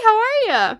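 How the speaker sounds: high, loud, hearty hello; calling out a greeting. Real, not acted. Streamer greets another player, high loud call. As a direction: sing out a big greeting.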